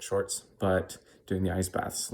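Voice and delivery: ordinary talk, nothing marked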